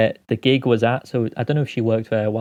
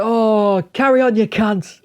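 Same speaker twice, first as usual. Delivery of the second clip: high, raised mock heckler voice; imitated heckler; 9 semitones higher, 3 dB louder, slower than usual. Heckler telling the departing comic to continue, high mock voice. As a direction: call out as the heckler egging him on.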